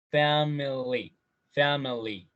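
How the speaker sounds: family stretched out slowly. Shown as a demonstration. Teacher saying family slowly first. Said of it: "Si lo decimos despacio".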